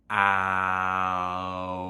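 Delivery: slow vowel glide from A to O; A gliding to O. Shown as a demonstration. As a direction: slide the vowel smoothly from A to O. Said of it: "start gliding to an O".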